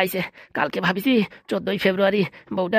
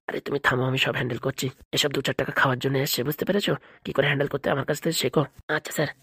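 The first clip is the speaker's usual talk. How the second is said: low, much softer aside, muttered to a colleague; hushed aside; 8 semitones lower, 5 dB quieter than usual. Acted. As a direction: lean in and say it under your breath.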